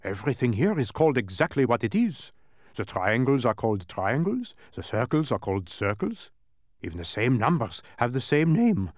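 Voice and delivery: exasperated, emphatic explaining; bewildered dodecahedron. A narrator's reading. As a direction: argue it as if it were absurd. Dodecahedron explains names, confused.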